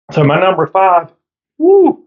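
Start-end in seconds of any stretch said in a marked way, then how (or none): 1.6-2.0 cracking voice like puberty. Real, not acted. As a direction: voice cracks up high on a woo. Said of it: "sound like I'm going through puberty".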